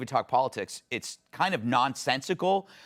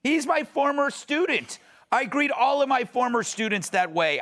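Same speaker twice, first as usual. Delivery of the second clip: pitched way up, playing a character; a mock-innocent excuse in a raised voice; high acted voice; 9 semitones higher than usual. Host playing Larry David's friend in a sketch, high mock-innocent voice. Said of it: "And scene".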